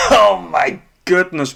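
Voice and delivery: high laugh into a slow oh my goodness; incredulous laugh. Real, not acted. Streamer laughing at Adesanya's brawling, disbelief.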